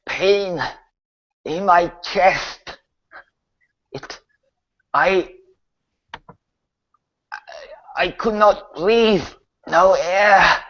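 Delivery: labored, broken phrases with long gaps; dying Hazel. Acted. Corpse recalls what killed it, gasping and halting. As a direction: struggle for each word, as a corpse short of air. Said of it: "What killed you?".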